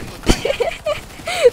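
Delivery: squeaky high giggle burst; a sudden shrill-free high cackle of delight; high laughing burst; high-pitched laugh. Real, not acted.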